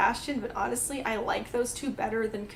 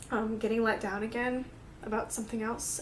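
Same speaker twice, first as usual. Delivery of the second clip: usual speaking voice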